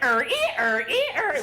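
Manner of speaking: loud see-sawing squeak, leaping high; imitated squeaking bed. Storyteller imitates a pull-out couch at any slight movement, loud squeaking. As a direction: creak like worn-out springs. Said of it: "the loudest noise ever".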